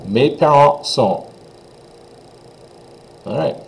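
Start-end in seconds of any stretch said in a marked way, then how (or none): 0.1-1.2 Mes parents sont slow with nasal vowels; teacher modelling French; French pronunciation. Shown as a demonstration. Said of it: "So one more time".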